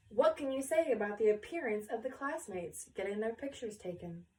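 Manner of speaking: ordinary delivery, nothing marked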